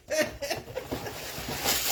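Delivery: airy high giggle; high breathy laugh. Real, not acted. Laughing at own French bit.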